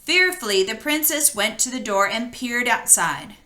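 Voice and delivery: usual speaking voice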